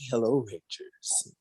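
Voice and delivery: low, quiet, menacing villain greeting; evil Tommy voice. Retold or imitated. Podcaster voicing villain Draken greeting the Rangers, evil Tommy voice. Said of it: "the evil Tommy voice".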